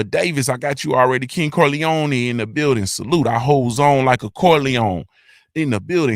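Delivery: usual speaking voice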